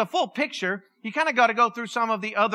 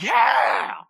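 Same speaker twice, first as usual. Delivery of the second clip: cheering fan; 3 semitones higher, 3 dB louder than usual. Pretend. Pastor imagining himself cheering Jesus on in the temple, loud and excited. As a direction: whoop like a fan in the stands. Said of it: "I'd have been the one that's like".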